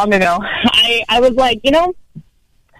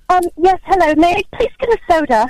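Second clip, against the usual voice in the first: higher, prim put-on British voice; fake British accent; 7 semitones higher than usual. [name] ordering at a drive-through, prim British accent. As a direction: order the soda in an over-polite British accent. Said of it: "so much nicer when you say you're like".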